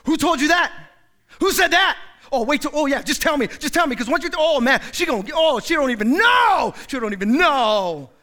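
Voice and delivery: loud, high, worked-up demands; defensive agitation. Acted. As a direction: get riled up to hear the gossip. Defensive gossip reaction.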